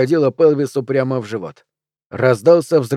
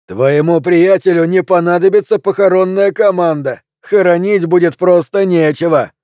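high, loud jeer; shouted taunt; 5 semitones higher, 5 dB louder than usual. Acted. Barman jeering at Flint across the bar, loud and high. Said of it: "крикнул Флинту".

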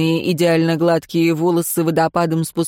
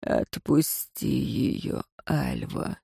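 much lower and very soft, very slow; bored master calling off his servant, sighing; weary sigh; 6 semitones lower, 10 dB quieter, much slower than usual. Acted. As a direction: let it fall out tiredly. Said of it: "устало вздохнул Безликий".

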